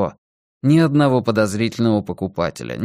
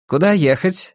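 higher-pitched, insistent question; demanding question; 7 semitones higher than usual. A narrator's reading. Driver asks his passenger where to go, demanding. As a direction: ask as if demanding exact directions. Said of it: "тоном, каким требуют точных указаний".

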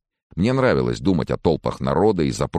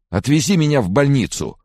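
high, loud urgent demand; panicked plea; 12 semitones higher, 5 dB louder than usual. A narrator's reading. Sick man asks friend for hospital, panicked. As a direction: beg to be rescued, pitched high.

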